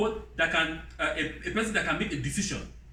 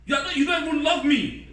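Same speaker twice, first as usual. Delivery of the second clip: mock outraged wife; 7 semitones higher than usual. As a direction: act out an outraged modern wife, pitched up. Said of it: "like the woman of now said".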